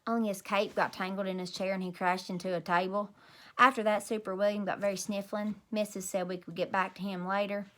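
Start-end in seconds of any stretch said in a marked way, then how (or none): none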